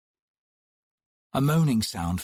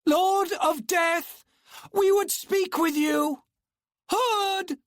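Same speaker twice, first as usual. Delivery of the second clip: slow, much higher summoning shout; calling out loud; slower than usual. Acted. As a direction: call out to the gate. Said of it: "raised her voice".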